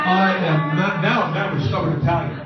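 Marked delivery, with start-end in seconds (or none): none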